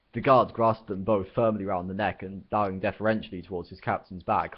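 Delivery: plain reading voice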